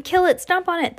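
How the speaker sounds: high-pitched urgent yells; shouted orders. A narrator's reading. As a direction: egg the others on, high-voiced. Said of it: "they were shouting".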